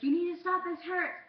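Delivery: high, loud pleading cry of pain; pained plea. Retold or imitated. Kid begging grandma to stop pulling, loud and pained. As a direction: beg her to stop, it hurts.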